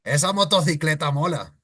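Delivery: Spain Spanish accent. Shown as a demonstration. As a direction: imitate a Spaniard. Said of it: "imitating them because that's how they sound".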